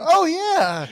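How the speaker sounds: high, loud, drawn-out knowing oh; like suddenly placing exactly who you are; a hammed-up oh of recognition; knowing oh yeah. Strangers recognizing which family.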